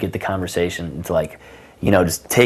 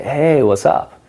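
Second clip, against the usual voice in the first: higher, drawn-in hello to a stranger; casual greeting; 6 semitones higher, slower than usual. Acted. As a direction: open with an upbeat, higher hey. Demonstrates greeting a girl.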